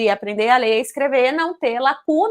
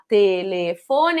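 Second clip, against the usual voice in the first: slow, lower "telefone", split into syllables; 6 semitones lower, much slower than usual. Shown as a demonstration. Child working out the teacher's word, "telefone" syllable by syllable. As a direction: sound out "telefone" slowly, piece by piece. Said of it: "uma forma silabada".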